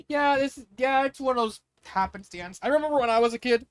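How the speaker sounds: suddenly eager, jumping off topic; excited burst. Real, not acted. Excited tangent breaks the story.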